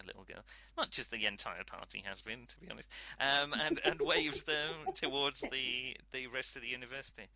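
normal talking voice

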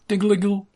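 high, bright doorbell ding; bell jingle imitation. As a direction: sing the shop bell chime.

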